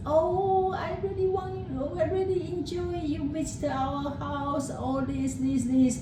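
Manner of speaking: high, gushing fake-nice voice; greeting the visiting mother-in-law, high and syrupy; overly sweet host. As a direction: gush at her with fake sweetness. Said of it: "treat your mother-in-law extremely nice".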